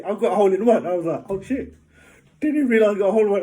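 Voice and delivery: thrilled golfer telling his hole in one; excited. Real, not acted. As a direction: blurt it out in disbelief.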